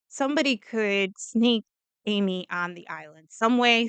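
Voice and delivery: ordinary delivery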